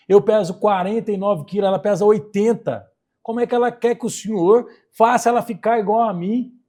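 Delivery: loud protest. Retold or imitated. Thin twin protesting to the surgeon, loud and exasperated. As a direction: throw the numbers out in outrage.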